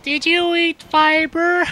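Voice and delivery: very high, slow, loud squeak; squeaky high voice. Acted. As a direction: squeak it out slowly. Squeaky joke voice.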